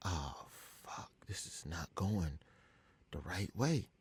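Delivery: hushed, low, slow aside to yourself; dawning dread. Retold or imitated. Prank caller realizing he was in trouble, hushed dread. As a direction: let it sink in, very softly. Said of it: "I knew I was in trouble".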